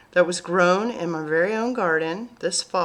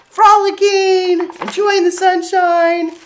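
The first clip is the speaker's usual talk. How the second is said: high, loud and drawn out; slow, gleeful storybook lilt; joyful; 10 semitones higher, 8 dB louder than usual. Acted. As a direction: paint a happy sunny scene.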